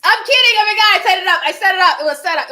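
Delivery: loud, high, tumbling protest; frantic backpedalling. Real, not acted. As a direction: hurriedly take back the joke, loud and high. Frantically taking back her joke.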